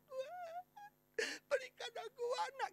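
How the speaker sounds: high, thin mock wail; drunken woman praying. Preacher as Hana begging God for a child, drunken wail. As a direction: imitate a drunk woman's tearful plea. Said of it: "Seperti wanita yang mabuk".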